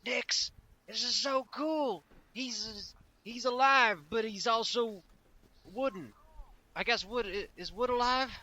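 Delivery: high, slow, amazed gushing; excited character voice. Acted. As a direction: gush over it in wonder. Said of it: "and is like".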